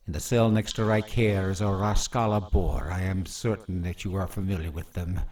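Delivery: cold, taunting villain voice; menacing villain. Acted. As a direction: savor every cruel threat. Villain taunting his captive.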